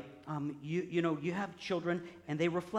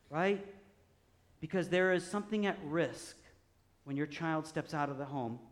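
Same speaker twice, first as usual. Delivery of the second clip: ordinary talk, nothing marked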